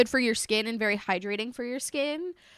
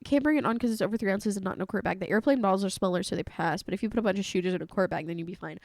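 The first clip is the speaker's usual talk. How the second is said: fast rattled-off rules; 5 semitones lower, 3 dB quieter, much faster than usual. Retold or imitated. Quoting rules rapidly.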